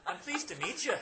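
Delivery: high, loud, hearty stage-Irish introduction; Irish accent. Acted. Publisher introduces himself and his paper, Irish accent. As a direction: introduce yourself like a proud Irish newspaperman. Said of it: "I'm gonna drop the accent".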